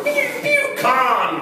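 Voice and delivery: high squeaky pew pew sound effects; mock sci-fi laser zaps; phaser noises. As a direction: play with a toy ray gun, going pew pew. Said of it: "running around going".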